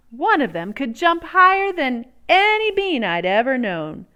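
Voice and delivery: usual speaking voice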